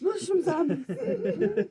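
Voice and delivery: high, slow laughing words. Real, not acted. Laughing during bird discussion.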